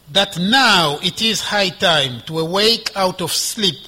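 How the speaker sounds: loud, measured proclamation of scripture; scripture reading. Acted. Preacher reads the passage to the congregation, scripture reading. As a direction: read it out emphatically, phrase by phrase.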